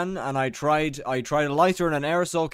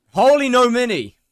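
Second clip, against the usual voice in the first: much higher and louder, bursting out the line; excited shout; 9 semitones higher, 8 dB louder than usual. Real, not acted. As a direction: yell it like a hype exclamation. Shouting chat message back.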